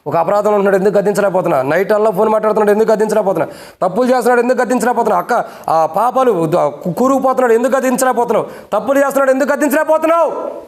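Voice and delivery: building preaching. Acted. Repeated question climbing.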